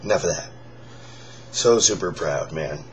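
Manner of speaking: hoarse, raspy near-whisper; forcing air through a worn-out voice; lost voice. Real, not acted. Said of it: "This is me with no voice".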